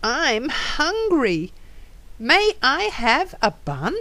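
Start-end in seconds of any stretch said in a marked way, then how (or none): none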